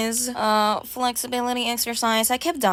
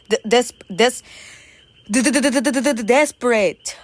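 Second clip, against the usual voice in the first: mock stutter; 4 dB louder than usual. Stuttering on desperate, played.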